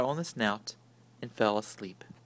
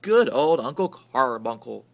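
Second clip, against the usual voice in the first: shouted; 9 dB louder than usual. A narrator's reading.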